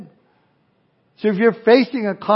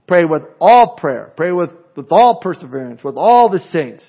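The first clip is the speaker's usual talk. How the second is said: "all" punched loud each time; stress on ALL; 6 dB louder than usual. Shown as a demonstration. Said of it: "how many times Paul uses the word all".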